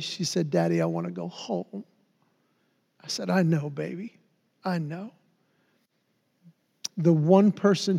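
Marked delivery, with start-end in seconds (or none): 0.5-1.8 a hint of a shaky small child; soft, trembling child's plea; quivering little girl. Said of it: "in her little four-year-old quivering voice". 3.3-5.1 soft, soothing father; gently comforting his little girl; tender. Retold or imitated. Comforting sick daughter.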